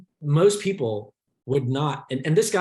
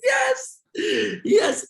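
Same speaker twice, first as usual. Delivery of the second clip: excited yes. Real, not acted. Excited agreement with friend.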